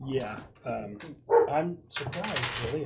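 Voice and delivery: normal talking voice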